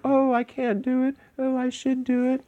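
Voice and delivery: imitating a grown-up who makes herself small like a child; small childlike voice. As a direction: shrink into a helpless little kid. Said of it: "make yourself small and like a child".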